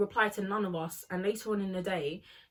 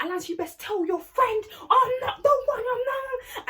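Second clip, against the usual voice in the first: much higher, louder shrieking yell; furious yell; 14 semitones higher, 5 dB louder than usual. Retold or imitated. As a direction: scream it like she's losing it. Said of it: "Tilly's like".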